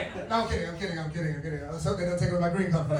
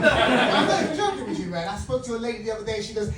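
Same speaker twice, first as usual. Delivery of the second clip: ordinary talk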